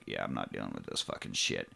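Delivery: very low, soft grumble; fed-up muttering. Retold or imitated. Bus passenger leaving the reeking men, low fed-up mutter. Passenger refusing to stay, acted.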